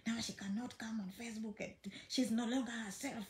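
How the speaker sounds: hushed, breathy gossip among friends; mock gossip, whispered very softly; imagined gossip whisper. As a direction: whisper it like nosy people talking behind her back. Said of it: "I'm like".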